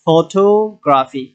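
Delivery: slow photography, টো held long; careful teaching photography, peak on টো; stress on টো. Shown as a demonstration. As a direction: draw out টো in photography, then drop গ্রাফি. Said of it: "শেষের দিক থেকে তিন number এসে stress".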